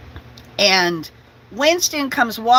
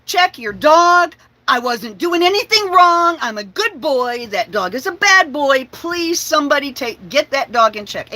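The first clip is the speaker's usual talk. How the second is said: higher, louder grumbling dog voice; dog storming off after being blamed, huffy and raised; indignant dog; 6 semitones higher, 5 dB louder than usual. Retold or imitated. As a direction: complain like a wronged good boy. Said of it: "kept walking away like".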